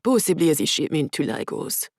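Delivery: a woman faking a man's voice; imitating Adrian. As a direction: slip into another man's voice. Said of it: "modulated her voice using the clan's Mockingbird's deception to imitate Adrian".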